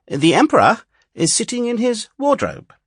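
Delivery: mock courtly announcement. A narrator's reading. Quoted saying inside read fairy tale.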